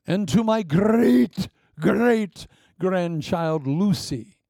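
grand, loud, slow will-reading voice; mock will reading. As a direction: read it out like a solemn lawyer. Reading Caleb's will aloud.

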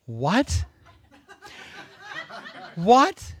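usual speaking voice

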